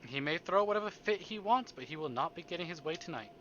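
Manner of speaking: ordinary talk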